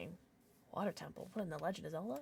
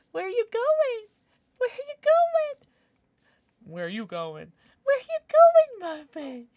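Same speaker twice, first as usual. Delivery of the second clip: high sing-song calling after the pet; cooing pet voice; 13 semitones higher, 6 dB louder than usual. Real, not acted. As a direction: call sweetly after a wandering puppy.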